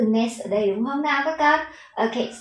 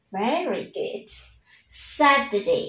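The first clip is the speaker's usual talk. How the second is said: usual speaking voice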